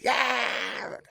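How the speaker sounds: high, stretched-out cheer; mock victory cheer. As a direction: imitate rowdy winners celebrating. Imitating winners celebrating.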